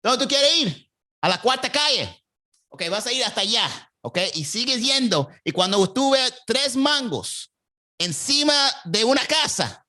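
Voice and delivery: high, lively Cuban Spanish giving directions; Cuban accent. Retold or imitated. A Cuban local giving directions with gossip, high and lively. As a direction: play a chatty Cuban neighbour pointing the way. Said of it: "my Cuban accent was on point".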